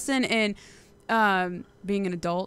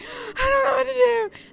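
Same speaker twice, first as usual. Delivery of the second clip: high, slow, wailing mock cry; imitating her own crying, high and slow; mock sobbing; 14 semitones higher, slower than usual. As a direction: act out the helpless sob, high and drawn out. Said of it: "I'm sobbing".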